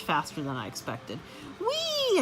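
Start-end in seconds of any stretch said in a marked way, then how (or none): 1.6-2.2 very high excited squeal; squeaked whee. Acted. Hamster squeaking whee as he slides. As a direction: squeal with joy down the cord. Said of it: "I squeaked as I slid".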